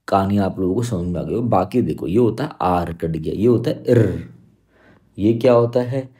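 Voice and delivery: ordinary talk, nothing marked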